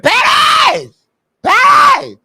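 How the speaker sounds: high, loud calling out; shouting a name. Retold or imitated. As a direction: call her name across the room. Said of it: "When you got on there and said".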